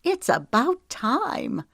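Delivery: high, drawn-out delighted tease; bubbling amusement. A narrator's reading. Woman teasing her partner as he proposes, bubbling with amusement. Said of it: "Laughter bubbled in her throat".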